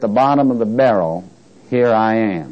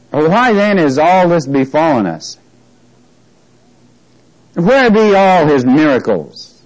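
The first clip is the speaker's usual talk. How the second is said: slow, stretched scripture declamation; acted-out protest, pitched up; dramatic complaint; 3 semitones higher, slower than usual. Reads Gideon's complaint to God dramatically.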